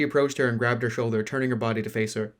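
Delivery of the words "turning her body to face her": plain reading voice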